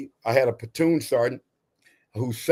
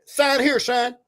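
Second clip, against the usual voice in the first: high-pitched East Tennessee sergeant impression; Claude Higgs; 10 semitones higher, slower than usual. Sergeant calls soldier into office to sign, high mimicked accent. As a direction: imitate the sergeant's high Southern order. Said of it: "called me in his office one day and said".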